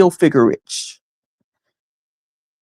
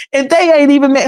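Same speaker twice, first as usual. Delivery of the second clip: ordinary talk, nothing marked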